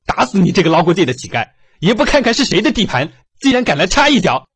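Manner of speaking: high, loud thuggish threat; loud warning. Acted. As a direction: bellow the threat while kicking. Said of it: "嘴里不停大声警告".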